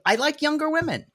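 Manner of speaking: mocking voice. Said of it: "mocking Big Ed".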